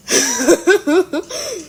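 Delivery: a burst of genuine laughter; real laugh. Real, not acted. Laughing at bouncing states.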